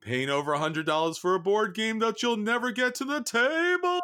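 high, climbing ghostly voice; hammed-up tale of dread, rising to a wail; mock horror wail. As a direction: build to a long wailed last word. Mock horror stories for board gamers.

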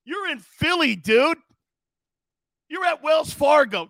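loud, high ranting call-out; mock yelling at a player. Real, not acted. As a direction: shout him down like a heckling fan.

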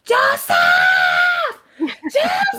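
high-pitched hysterical scream of a name; imitated frantic yelling, very high; mock shrieking aunt. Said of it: "Lo que hacía era gritándole".

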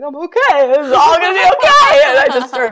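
high, loud, tearful release; crying relief. Retold or imitated. Telling her husband she's okay while crying, high and loud. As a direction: break into relieved tears. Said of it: "I'm like".

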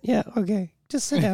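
soft, drained mumble; numb acted reply. Shell-shocked paramedic at home.

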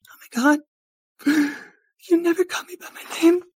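very high, loud squealing cry; mock tearful gush. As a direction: gasp and blubber, hammed up. Tearful girl moved at hearing name.

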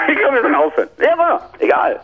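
giggly, high-pitched and tipsy; laughing. Real, not acted. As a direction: laugh through the words.

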